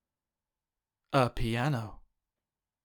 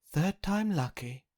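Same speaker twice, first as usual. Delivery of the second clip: higher, brighter-toned hopeful mother; 7 semitones higher than usual. Acted. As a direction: cheer him on for the next gift.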